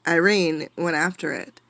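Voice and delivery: usual speaking voice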